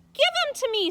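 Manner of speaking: put-on high, loud childish scream; shrill loud plea. A narrator's reading. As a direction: scream it like a desperate girl. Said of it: "screamed Abilene".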